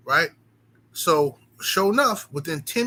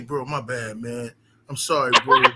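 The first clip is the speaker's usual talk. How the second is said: mock groveling apology; 6 semitones lower than usual. Imitating the wallet thief apologizing, low and sheepish. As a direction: play the caught thief begging low. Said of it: "you can't apologize to me and say".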